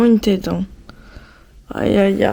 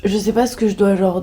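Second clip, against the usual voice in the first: usual speaking voice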